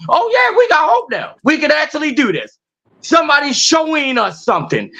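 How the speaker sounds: high-pitched, excited follower voice; newly hopeful. Acted. People seeing their leaders build, newly hopeful. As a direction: act out people suddenly catching hope, pitch jumping up. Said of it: "give your people some hope".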